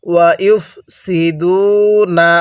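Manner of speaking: slow drawn-out tajweed recitation; Quranic recitation. Shown as a demonstration. Teacher leading students to repeat, slow recitation. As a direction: stretch each syllable for the class to repeat. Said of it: "কিভাবে পড়ব".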